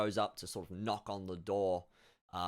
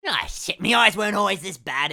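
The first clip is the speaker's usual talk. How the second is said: higher, louder grumbling about his eyes; squinting old farmer; 9 semitones higher, 13 dB louder than usual. Acted. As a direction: grumble while peering at the page. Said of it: "looks ... and goes".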